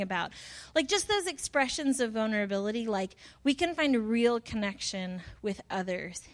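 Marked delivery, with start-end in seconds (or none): none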